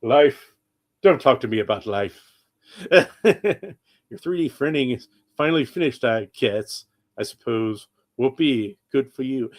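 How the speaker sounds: lightly voiced gloomy robot; bored, drawn-out depressed robot; Alan Rickman robot voice.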